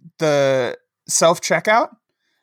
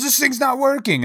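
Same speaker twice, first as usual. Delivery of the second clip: indignant shout; 10 semitones higher than usual. Retold or imitated. Old man yelling at the grocery clerk, high exasperated shout.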